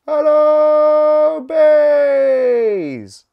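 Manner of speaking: high, very loud long call; shouting. Acted. Scout shouting to the busy bees for their attention, very loud. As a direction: call across the arcade to get their attention. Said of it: "shouted Scout".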